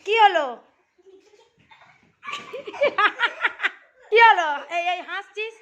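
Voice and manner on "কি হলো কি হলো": loud, rising call across the room. Real, not acted. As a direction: demand an answer loudly. Calling out to laughing child. (জোরে ডাক)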